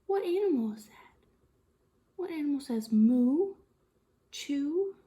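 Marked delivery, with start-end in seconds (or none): none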